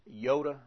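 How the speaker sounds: iota with a very quick little I, yota. Shown as a demonstration. Teacher demonstrates the quick Greek iota. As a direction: clip the i so iota sounds like yota. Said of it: "I'm giving a very quick little I".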